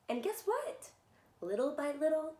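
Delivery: ordinary delivery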